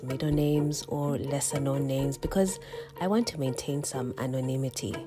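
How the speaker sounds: ordinary delivery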